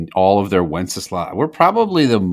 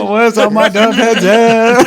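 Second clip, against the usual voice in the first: high, loud and slow hype call; goofy Wenceslas voice; 14 semitones higher, 8 dB louder than usual. Pretend. Host calls his fans as Wenceslas, high and slow. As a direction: call out to the fans like a cartoon king.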